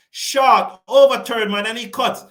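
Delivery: sudden loud commentary call; excited call. Real, not acted. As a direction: burst out loud on the shot. Live call of a boundary shot.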